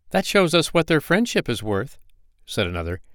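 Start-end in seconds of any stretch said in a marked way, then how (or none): 0.1-2.0 higher, resentful little firefly; indignant firefly. Acted. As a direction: sniff at false friends, pitched up. Another firefly speaks.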